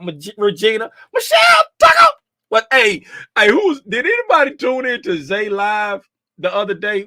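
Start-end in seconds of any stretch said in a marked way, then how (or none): 1.1-2.1 hype shout. Pretend. Announcing viewer name loudly.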